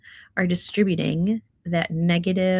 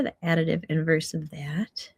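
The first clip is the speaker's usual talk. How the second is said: normal talking voice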